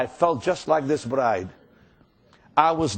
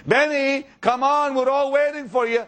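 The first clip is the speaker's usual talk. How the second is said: hammed-up hostess shouting up the stairs; retold calling out; 8 semitones higher than usual. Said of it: "she kept screaming at me".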